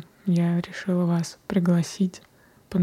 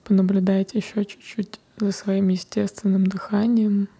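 guided meditation. Acted. Closes the meditation.

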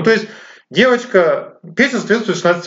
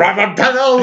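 [name] in a mock German accent; 6 semitones higher than usual. Shown as a demonstration. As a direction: say the name like a German speaker. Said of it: "я лишь могу сказать".

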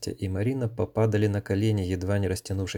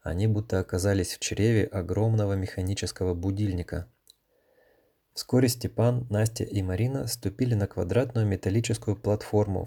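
ordinary delivery, nothing marked

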